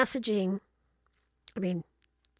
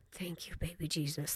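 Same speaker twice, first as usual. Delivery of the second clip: whispered aside; 5 semitones lower, 11 dB quieter than usual. Real, not acted. Grateful aside under breath.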